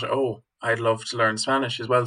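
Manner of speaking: usual speaking voice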